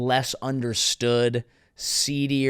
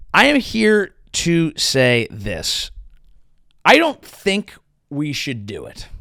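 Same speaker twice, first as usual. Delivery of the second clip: slow and deliberate; 4 dB louder, slower than usual. Real, not acted. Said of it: "slow emphatic announcement".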